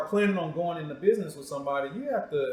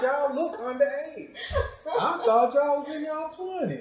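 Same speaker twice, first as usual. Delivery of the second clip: laughing tease; 9 semitones higher than usual. Real, not acted. Host teasing the couple that they look too young, laughing. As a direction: tease them through a laugh.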